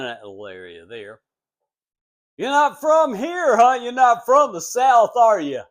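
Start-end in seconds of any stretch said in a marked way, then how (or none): 2.4-5.6 much higher and much louder, teasing the outsider; high, loud ribbing of a newcomer; mocking locals. Southern locals mocking the Yankee salesman, high and loud.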